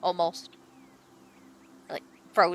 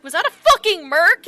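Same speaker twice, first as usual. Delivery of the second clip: higher, louder disbelief; startled reaver; 8 semitones higher, 8 dB louder than usual. Acted. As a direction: shout after the merc. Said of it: "he's like".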